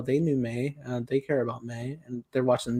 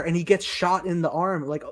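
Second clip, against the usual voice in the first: ordinary talk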